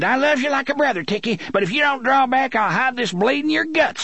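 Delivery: high, rapid pleading threat; high-pitched hurried warning; panting, desperate threat. A narrator's reading. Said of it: "he panted".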